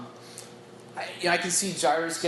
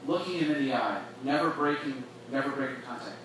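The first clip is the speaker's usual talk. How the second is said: normal talking voice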